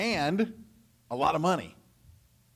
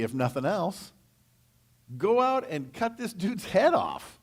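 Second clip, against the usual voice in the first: rising outrage; 6 semitones higher, 3 dB louder than usual. Retold or imitated. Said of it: "And David's like".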